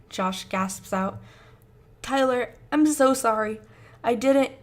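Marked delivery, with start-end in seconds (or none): 2.0-4.5 panicked apology. Acted.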